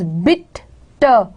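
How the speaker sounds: "bitter" split, weight on bit, then a separate ter; stress on BIT. Shown as a demonstration. Teacher pointing out the stressed syllable, "bitter" slowly split with BIT stressed. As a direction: stress the first half of "bitter" and detach the end. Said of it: "The stressed one".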